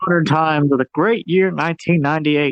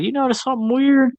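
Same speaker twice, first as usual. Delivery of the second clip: high gossipy voice; 8 semitones higher than usual. Retold or imitated. Character voiced in retelling.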